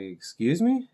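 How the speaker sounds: high, rising mock-baffled question; incredulous retort. Reacting to a friend's unfamiliar slang, incredulous.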